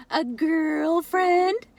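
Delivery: slow, high mock-suggestive tease; teasing drawl. A narrator's reading. Ace teases about Uncle Spyro meeting a girl, slow sly tease. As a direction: stretch it out like a sly joke.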